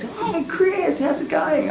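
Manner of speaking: put-on high, bright girlish greeting; an old friend pops out of the bushes, high excited hello; Kathy calling out. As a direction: squeal it like a surprised old friend. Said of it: "and she goes".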